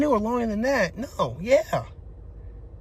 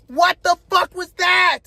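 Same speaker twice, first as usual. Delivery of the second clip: high, drawn-out incredulous yell; retold outraged demand; 7 semitones higher, 4 dB louder, slower than usual. Man demanding an explanation from her, drawn-out outraged yell. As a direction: stretch the words in exasperation. Retold confrontation over hidden boyfriend.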